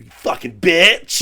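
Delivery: slow, loud, drawn-out insult; mock furious yell; retold yell. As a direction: bellow it as he charges in. Dave charging in yelling insult.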